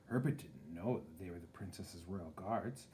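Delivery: plain reading voice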